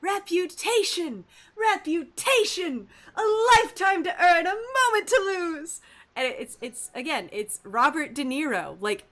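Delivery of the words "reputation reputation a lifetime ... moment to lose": high, loud anguished screaming. Retold or imitated. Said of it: "[name] scene where he screams".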